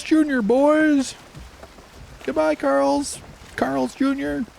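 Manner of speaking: very high, loud cartoon parent; mock parent voice. Parent telling kids to wave goodbye, high playful voice. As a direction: squeal out silly farewells to passing things.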